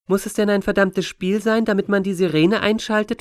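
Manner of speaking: put-on a kid challenging with a question; questioning child. A narrator's reading. Astor challenging Deborah about the siren, questioning child. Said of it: "fragte Astor".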